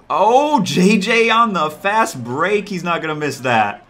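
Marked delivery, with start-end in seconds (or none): 0.1-2.7 drawn-out hype call; excited. Real, not acted. Hyping a fast break.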